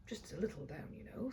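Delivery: whispered confidingly; a hushed little aside. Acted. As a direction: lean in and say it softly.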